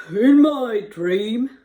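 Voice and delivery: loud, very slow character voice; baker voice. Acted. Baker begins telling Joseph his dream, big character voice. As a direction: heave it out as the baker. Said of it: "Here we go".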